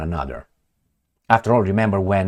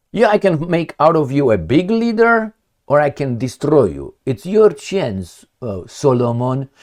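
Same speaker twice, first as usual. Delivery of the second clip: lightly acted ultimatum, pitched up, slowing at the end; Putin; 5 semitones higher than usual. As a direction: lay out the threat like a leader to an underling. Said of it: "that Putin told Sarkozy".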